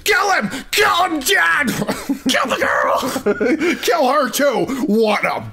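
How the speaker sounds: high cackling shrieks; screaming granny. Acted. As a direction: scream for blood, laughing. Grandma cheering bloodthirsty.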